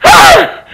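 very high wailed "hey"; anguished cry. Acted. Uncle with nowhere to go, anguished cry. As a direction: wail it high in despair.

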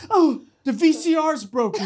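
imitated mom's excuse. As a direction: blurt a lame excuse as mom. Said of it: "And she's like".

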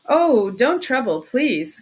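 loud, agitated protest; crying out. A narrator's reading. Suspect refusing the investigator's offer, crying out. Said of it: "cried Raskolnikov".

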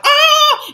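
one high, loud wail of panic; crying scream. Retold or imitated. Crying as the cop car crashes, high wail. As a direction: let out a single panicked cry. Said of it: "And I'm crying and I'm like".

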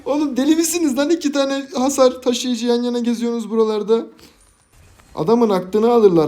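high, loud disbelieving rant; stunned gamer ranting at the enemy; incredulous outburst. Real, not acted. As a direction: exclaim in amazed outrage. Scolding enemy duo in disbelief.

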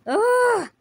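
sharp high yelp of pain; short loud scream of pain; pained battle cry. Acted. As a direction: cry out as the blow lands. Fighter cries out in battle.

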